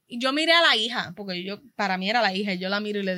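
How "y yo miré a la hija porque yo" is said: normal talking voice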